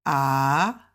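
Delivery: AH dipping down and back up; third tone. Shown as a demonstration. Teacher demonstrates Mandarin tones on AH, dipping third tone. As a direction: sink low on AH, then swoop up. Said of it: "is down and up, third tone".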